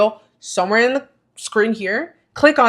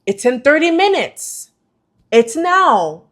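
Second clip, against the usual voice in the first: higher, singled-out announcements imitating email alerts; mimicked reminder alerts; 7 semitones higher, 4 dB louder than usual. Creator mimicking the webinar alerts she received, urgent reminders. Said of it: "receiving mini alerts every".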